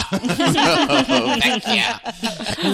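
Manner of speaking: loud, high laughing "Weg hier!"; laughing exclamation. Retold or imitated. Student quits law school, laughing loudly.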